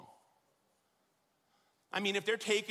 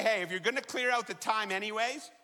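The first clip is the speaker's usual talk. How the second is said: higher and louder, stressing "don't need"; imagine a fed-up mom begging her kids; mother's exasperated loud plea; pleading mother; 4 semitones higher, 5 dB louder than usual. Pretend. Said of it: "Would you say".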